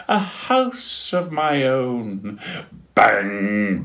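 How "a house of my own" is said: sighing. Acted. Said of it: "sighed Dudley".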